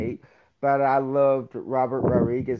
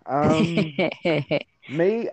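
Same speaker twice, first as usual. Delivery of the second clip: ordinary delivery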